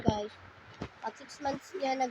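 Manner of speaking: normal talking voice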